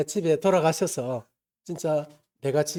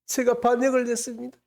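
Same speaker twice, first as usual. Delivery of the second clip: crying confession; 8 semitones higher than usual. Retold or imitated. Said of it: "하면서 막 엉엉 우는 거예요".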